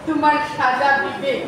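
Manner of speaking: ordinary delivery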